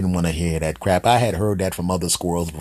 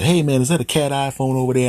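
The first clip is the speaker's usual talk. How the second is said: higher-pitched dog character voice; eager dog; 7 semitones higher, 4 dB louder than usual. Acted. Neighbour's dog asking the man about the phone, eager higher voice. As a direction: ask brightly as a talking cartoon dog.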